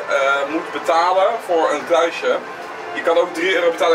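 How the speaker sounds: ordinary delivery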